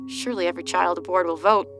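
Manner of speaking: plain reading voice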